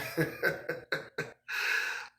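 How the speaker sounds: long breathy chuckle; laugh. Real, not acted. Man amused at thinking himself a man, breathy laughter. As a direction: laugh softly to yourself, trailing on.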